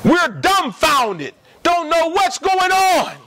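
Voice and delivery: slow, drawn-out preaching. Acted.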